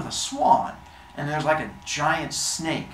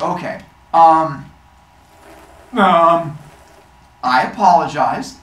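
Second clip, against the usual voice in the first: loud, halting stammer with long pauses; stall, then apologize; awkward apology; 2 semitones higher, 9 dB louder than usual. Acted. Athena facing the crowd after the shock, halting and awkward. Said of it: "And she's like".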